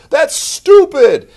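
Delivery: high, loud, slow mock outrage; mocking critics. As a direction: sneer it like a scornful critic. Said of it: "all these prophecy people are like".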